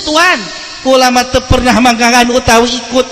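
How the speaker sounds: ordinary talk, nothing marked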